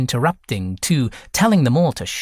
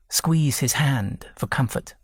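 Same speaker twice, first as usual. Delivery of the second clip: ordinary delivery, nothing marked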